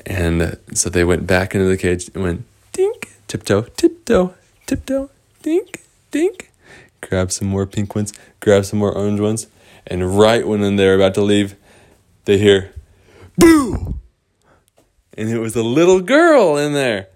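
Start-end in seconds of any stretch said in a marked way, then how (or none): 2.7-6.5 high, dainty step noises; tiptoe dinks. Acted. Storyteller acting the sneak back in, high dinks. 13.4-13.9 sudden high-pitched boo; playful scare. Acted. Hidden girl startling the flower pickers, high boo.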